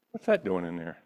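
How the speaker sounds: normal talking voice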